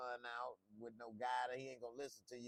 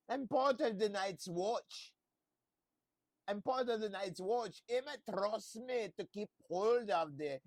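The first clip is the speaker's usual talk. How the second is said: high, loud, fussy gushing voice; Papa Tarly impression; 7 semitones higher, 5 dB louder than usual. Show host imitates Papa Tarly flirting at the counter, high and gushing. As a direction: gush it like a giddy sheltered nerd. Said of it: "That's my Papa Tarly impression".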